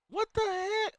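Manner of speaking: very high-pitched, rising squeal of confusion; baffled disbelief. Real, not acted. Gamer who doesn't know what happened, baffled disbelief. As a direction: squeal it out, utterly thrown.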